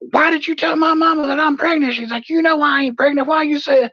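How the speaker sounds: put-on angry girlfriend. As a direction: accuse him in a high voice.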